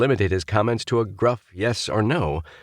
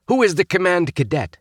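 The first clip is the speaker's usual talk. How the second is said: stern; 12 semitones higher, 4 dB louder than usual. A narrator's reading. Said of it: "suppressing a grin".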